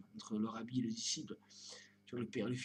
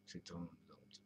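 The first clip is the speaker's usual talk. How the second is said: breathy whisper, much softer; 4 semitones lower, 10 dB quieter, slower than usual. Acted. Musing vlogger echoing his phrase to himself, whispered. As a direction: let it fall to a secret whisper. Repeats the phrase two ways.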